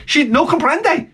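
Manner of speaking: high, slow mock of the tenant. To the landlord, mocking the tenant's no comprende. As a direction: mimic her feigned confusion.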